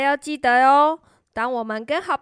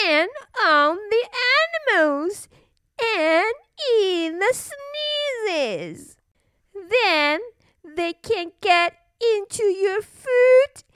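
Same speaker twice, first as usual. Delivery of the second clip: spooky storyteller maid; 7 semitones higher, slower than usual. Acted. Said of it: "said the maid".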